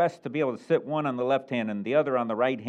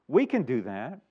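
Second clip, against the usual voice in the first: higher, louder eager reply; overconfident disciples; 7 semitones higher, 4 dB louder, slower than usual. Retold or imitated. Disciples answering Jesus about the cup, eager and higher. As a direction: brush it off like it's easy. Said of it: "They have confidence".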